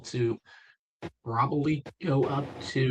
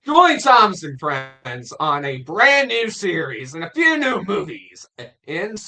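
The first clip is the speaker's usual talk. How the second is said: trailer voice; 6 semitones higher, 7 dB louder than usual. Shown as a demonstration. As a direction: boom it out like a film trailer. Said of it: "can you do, like, a good trailer voice?".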